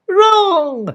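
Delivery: high, clear heroic call; pure clear voice. A narrator's reading. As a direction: ring out from above. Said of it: "said a pure, clear voice from above".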